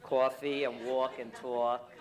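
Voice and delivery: loud, slow Brooklyn vowels; exaggerated Brooklyn accent, drawn out and loud. Shown as a demonstration. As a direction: lean hard on the Brooklyn "aw". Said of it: "that's how you speak".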